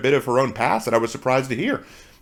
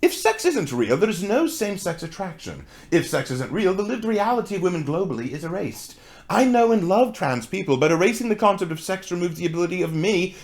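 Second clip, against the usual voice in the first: a light, attempted British woman accent, a little higher; 3 semitones higher than usual. Reading the author's tweets aloud to viewers in a mild British accent. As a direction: read the tweet in your best British woman accent, not quite landing it. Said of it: "I was trying to do my best British woman accent".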